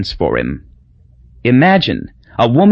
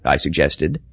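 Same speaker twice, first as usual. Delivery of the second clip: usual speaking voice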